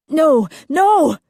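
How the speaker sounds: high, loud cry of no; desperate. Acted. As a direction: cry out in panic. Desperate refusal in nightmare.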